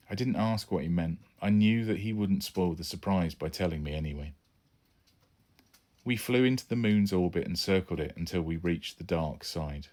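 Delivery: ordinary delivery, nothing marked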